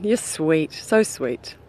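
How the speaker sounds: normal talking voice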